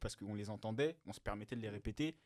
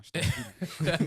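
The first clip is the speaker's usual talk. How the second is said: mocking laugh; 7 semitones higher, 10 dB louder, slower than usual. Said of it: "Ça, c'est vraiment une expression de campagnard".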